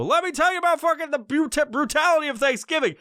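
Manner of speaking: high, put-on oddball voice; hammed-up high voice, slowing on space aliens; weirdo rant voice. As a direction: play the guy rambling at strangers. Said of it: "go out to fucking Starbucks and go".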